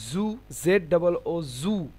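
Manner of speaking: জু with a clear buzzing Z, slow; slow English-style Z in জু. Shown as a demonstration. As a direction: spell it out, buzzing the জু. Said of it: "জেডের মতো উচ্চারণ হয়".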